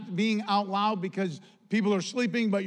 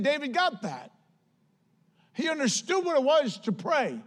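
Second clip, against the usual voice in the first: preaching at full force; 4 semitones higher than usual. Acted. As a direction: preach it hard to the church.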